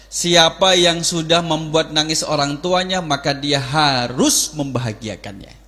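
usual speaking voice

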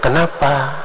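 mild, patient question; gentle intonation. Shown as a demonstration. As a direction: ask "Kenapa" gently, without any snap. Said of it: "dengan intonasi yang lembut".

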